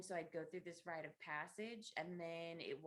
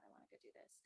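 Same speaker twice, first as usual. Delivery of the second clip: softer, breathy whisper of the wish; hushed echo of the child's wish; whispered inner child; 15 dB quieter, much faster than usual. Retold or imitated. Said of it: "my inner child's like".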